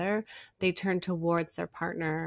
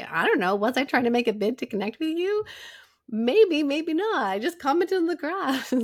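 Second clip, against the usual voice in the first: louder and higher, a hammed-up defensive husband; mock husband protesting, raised voice; 8 semitones higher, 7 dB louder than usual. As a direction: play the husband's half-joking denial. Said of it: "he could sit here with you and say".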